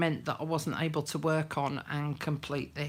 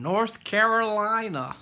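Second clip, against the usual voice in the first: loud, higher mock North Carolina accent; attempted North Carolina accent; 5 semitones higher, 7 dB louder than usual. Shown as a demonstration. Greeting a viewer from North Carolina, attempted North Carolina accent. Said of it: "I can't do the accent".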